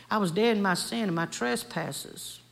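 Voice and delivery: ordinary talk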